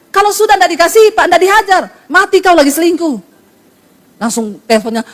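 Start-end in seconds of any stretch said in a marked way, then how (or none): 0.1-3.2 fast retort. Retold or imitated. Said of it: "Eh, Pak, Bapak tahu firman enggak".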